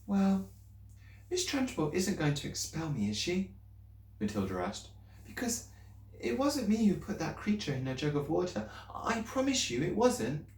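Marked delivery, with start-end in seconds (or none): 0.1-3.5 put-on higher, anxious little girl's voice; girl pleading with her teacher, higher and anxious; young Matilda. A narrator's reading. 5.3-10.5 put-on higher, anxious little girl's voice; girl pleading with her teacher, higher and anxious; young Matilda. A narrator's reading.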